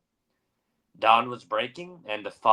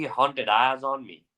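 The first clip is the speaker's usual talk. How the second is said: ordinary delivery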